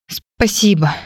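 a hint of a tremble; shaky thanks. Heroine thanks the man, voice trembling after crying. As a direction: thank him, voice wobbling from tears. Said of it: "поблагодарила дрожащим голосом".

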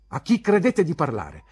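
loud, high, affronted question; indignant pupil answering back; defiant challenge. Acted. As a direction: challenge the teacher's authority. Pupil defies teacher in quarrel.

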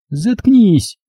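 high-pitched squealed outburst. A narrator's reading. Dovlyatova shuts up the man accusing her, squealing. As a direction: cut him off on a high note. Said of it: "взвизгнула Довлятова".

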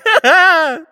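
high, loud wailing outburst; panicked wail. A narrator's reading. Panicking spy wanting to fly home, wailing. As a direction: break into a wordless wail.

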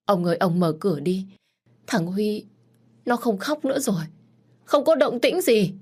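rising to high, loud tearful pleading; desperate begging. A narrator's reading. Mother begging her husband to free their son. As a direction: beg him, voice climbing to a wail. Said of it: "khẩn khoản van xin".

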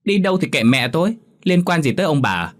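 acted insolent daughter, high-pitched start; contemptuous backtalk; defiant challenge. A narrator's reading. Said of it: "nhìn hai ông bà như thách thức".

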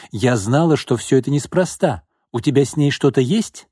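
a jealous woman's heated accusation; angry accusation. A narrator's reading. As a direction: confront him with rising anger. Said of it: "гневно сощурила глаза".

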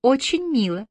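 high lilting compliment; sweetly sung-out; singsong. A narrator's reading. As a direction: coo it in a high, light voice. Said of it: "пропела Бобби".

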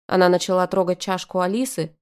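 plain reading voice

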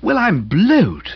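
high, slow astonishment; amazed exclamation. A narrator's reading. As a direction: draw it out, thoroughly amazed. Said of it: "I ejaculated, ibid".

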